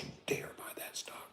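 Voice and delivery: hushed whispered warning. Real, not acted. Stock streamer warning a viewer off a stock, whispering. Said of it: "Why are we whispering?".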